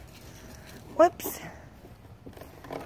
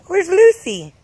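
excited coaxing call; 6 semitones higher, 9 dB louder, slower than usual. Real, not acted. Parent calling to toddler, loud and eager. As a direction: pitch it up and call out to her.

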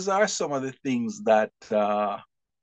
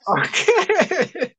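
high, loud laughing okay; laughing okay before answering; burst of laughter; 6 dB louder than usual. Real, not acted. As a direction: caught off guard, laugh it out. Laughing reaction to tough question.

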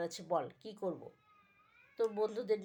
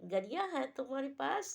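higher, gushing Hindi-tinged voice; impressed girls; 6 semitones higher than usual. Acted. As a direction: gush like dazzled girls. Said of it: "মেয়েরা বলে". (উচ্ছ্বসিত মেয়েলি গলা)

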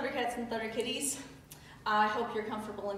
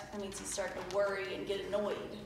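ordinary talk, nothing marked

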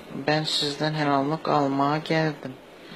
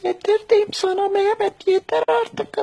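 loud high falsetto mom yelling; shrieking mother, high and loud; turkish cartoon dub; 6 dB louder than usual. Falsetto mother yells at husband.

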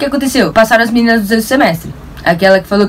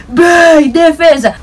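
shouted celebration; 6 semitones higher, 3 dB louder, much slower than usual. Retold or imitated. Celebrating her save.

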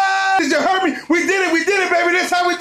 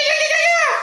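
very high squealing yeahs; high excited yeahs; 13 semitones higher, faster than usual. Real, not acted. Fan squealing over beating the Saints. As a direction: shriek them with joy. Celebrating win.

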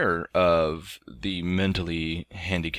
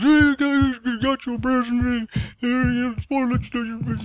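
much higher put-on voice, rushing at the end; imitating the lady's speech. Dad retelling the lady handing over the present, high put-on voice. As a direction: chirp it out the way she said it. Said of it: "the one that talks, uh, kind of funny".

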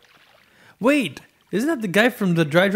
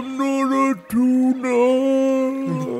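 very slow, high stretched-out whale voice; 8 semitones higher, much slower than usual. Acted. Friend voicing the whale, dragging each word slowly. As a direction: drag it out like a big dopey whale. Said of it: "what kind of voice should I give the whale".

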